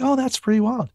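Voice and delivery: very high mimicked amazement; airy mock wonder; impressed listener. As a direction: imitate someone mildly wowed, voice way up. Imitates impressed woman.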